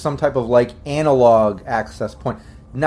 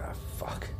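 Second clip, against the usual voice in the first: whispered, weak curse; hurt prisoner; 19 dB quieter than usual. Acted. Game master as prisoner coughing blood, whispering weakly. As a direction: whisper it as a wounded captive. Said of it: "Kinda coughs up more blood".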